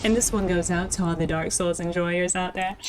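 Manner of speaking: smooth radio host dedication. Acted. Streamer dedicates the shout-out to Dark Souls fans, radio DJ voice. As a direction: spin it like a late night radio DJ. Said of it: "why that sounded like a radio".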